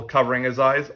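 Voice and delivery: usual speaking voice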